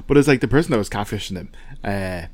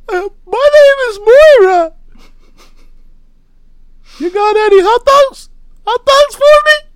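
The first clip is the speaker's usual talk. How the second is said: very high squeaky woman's voice; high female voice; 8 dB louder than usual. Pretend. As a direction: pitch it way up like a woman. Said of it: "I'll really try".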